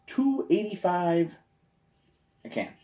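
slow, drawn-out price announcement; slow, deliberate "two eighty-five a can"; slow first take. Shown as a demonstration. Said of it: "say it again".

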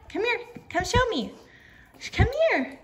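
high-pitched coaxing call; calling the dog. Real, not acted. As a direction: call a pet over, pitched right up. Calling her dog over.

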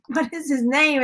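ordinary delivery

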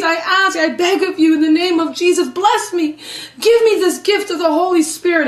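high, insistent begging prayer; pleading before the Lord. Retold or imitated. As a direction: beg earnestly, reenacting a desperate plea. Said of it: "I'm sobbing before the Lord saying".

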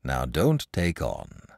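low, slow, soothing; calm a crying child gently; reassuring. A narrator's reading. Saint comforts the crying boy.

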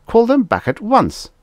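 loud, very high command; alarmed boss giving an urgent order; outraged order. A narrator's reading. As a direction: demand it, voice shooting up. Outraged ambassador orders fleet recalled.

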